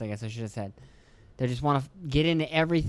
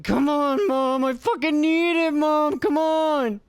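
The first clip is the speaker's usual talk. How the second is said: much higher, louder, slow whining plea; begging kid; 13 semitones higher, 5 dB louder, slower than usual. Retold or imitated. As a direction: imitate an addicted kid begging mom. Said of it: "It's like".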